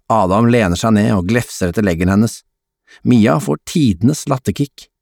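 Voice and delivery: ordinary delivery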